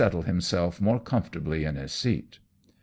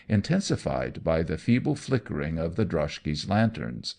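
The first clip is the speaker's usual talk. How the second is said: plain reading voice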